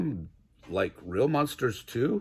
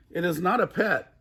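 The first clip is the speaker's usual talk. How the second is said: mild mock yell; 6 semitones higher than usual. A narrator's reading. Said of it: "I yelled back".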